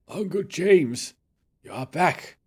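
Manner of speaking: slow, drawn-out invalid voice; confused old professor. Acted. Professor mistaking visitor for his uncle, slow and dazed. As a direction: draw it out as if lost in memory.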